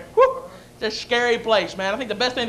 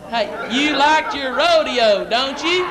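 drawn-out, slow-motion question; mockingly slow, word by word; talking slower; much slower than usual. Shown as a demonstration. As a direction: spell it out slowly for a cowboy. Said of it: "I'll talk slower".

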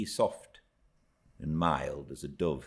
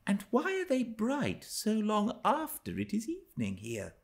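put-on much higher child voice, quickening with curiosity; a dreamy child gazing at far hills; young Cathy wondering. A narrator's reading. Cathy asks Ellen from the nursery window, high wondering voice. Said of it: "she pursued".

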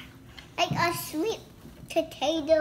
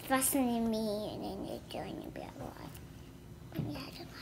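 whispering; 6 semitones lower, 14 dB quieter, faster than usual. Real, not acted. Hushed aside to camera.

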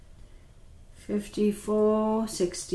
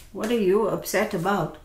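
ordinary talk

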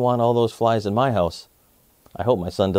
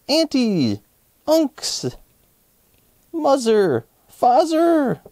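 rising higher, joyful buzzy greetings; excited fly voice; 4 semitones higher, slower than usual. Acted. Fly Guy hugs his whole family, building excitement. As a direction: buzz each name happier and higher.

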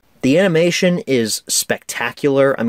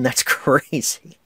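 ordinary delivery

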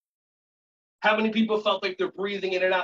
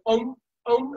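higher, short gulping bird noises; baby bird; 5 semitones higher, 4 dB louder than usual. Shown as a demonstration. Teacher leads a stretch as a fed chick, baby bird noises. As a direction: chirp like a chick waiting for food. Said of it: "like a little baby bird".

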